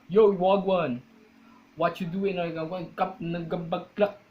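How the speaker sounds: hammed-up Jamaican greeting; Jamaican accent. Shown as a demonstration. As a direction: put on a Jamaican accent sliding into gibberish. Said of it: "it is like a Jamaican".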